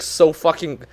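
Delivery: ordinary delivery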